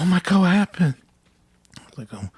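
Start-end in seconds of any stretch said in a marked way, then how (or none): 0.0-0.9 1 gasping classmate. Retold or imitated. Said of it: "I went to school and I was like".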